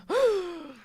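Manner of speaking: high, sharp intake of breath; mock shocked gasp. Podcaster acts out the people in line, shocked gasp. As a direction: gasp like an appalled bystander.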